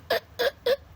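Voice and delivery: put-on high sharp baby hiccup sob; a little hiccup between cries; crying hiccup. Shown as a demonstration. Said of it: "those are the hiccups".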